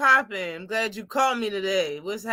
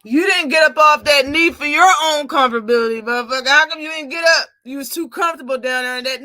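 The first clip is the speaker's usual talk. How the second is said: louder and higher, jeering; heated rant; 5 semitones higher, 8 dB louder, faster than usual. Real, not acted. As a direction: jeer at the fighter who stayed down. Mocking the fighter, heated.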